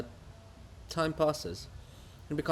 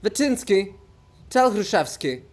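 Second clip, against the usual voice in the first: louder call across the street; 8 semitones higher, 9 dB louder than usual. Acted. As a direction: call after him. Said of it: "calls out".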